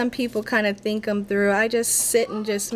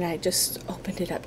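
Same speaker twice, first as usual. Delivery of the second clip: soft whisper under her breath; whispering to herself; 4 semitones lower, 7 dB quieter than usual. Real, not acted. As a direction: whisper it to yourself while you work. Muttering to herself while crafting.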